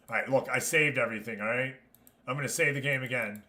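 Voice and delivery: ordinary talk, nothing marked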